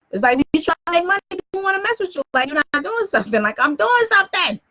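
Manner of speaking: high-pitched, exasperated rant; exasperated complaint. Real, not acted. Broadcaster interrupted while trying to work, high exasperated complaint. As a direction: pitch climbing high in protest.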